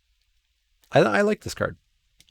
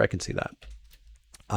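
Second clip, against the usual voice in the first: barely voiced, slow half-whisper to himself; whispered aside; 10 dB quieter, much slower than usual. Real, not acted. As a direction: drop to a soft whispered aside.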